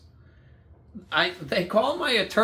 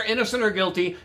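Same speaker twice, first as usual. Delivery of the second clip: ordinary delivery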